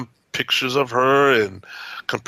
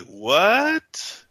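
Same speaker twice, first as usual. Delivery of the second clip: shocked what; 7 semitones higher than usual. Retold or imitated. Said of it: "and we're like".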